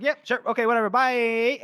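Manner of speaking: high, loud, airy dismissal; breezy brush-off. Acted. Gorst brushing off General Felnig, high breezy brush-off. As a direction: cheerfully cut them off in passing.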